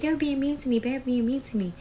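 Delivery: high, lightly voiced upset kid; imitated crying child. Daughter complaining to mom on the phone, imitated crying child. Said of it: "So she's crying, crying".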